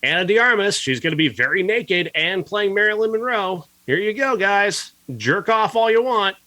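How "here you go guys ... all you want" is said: higher-pitched, sarcastic offer to an audience; mocking sales pitch. Critic mimicking a marketing campaign selling nudity, mocking. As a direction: pitch it up, scornfully playing the promoter.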